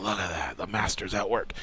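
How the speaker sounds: low, very soft imagined onlooker; acted hush of quiet appreciation; hushed admiration. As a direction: murmur it like an admiring expert. Imagined expert watching in admiration.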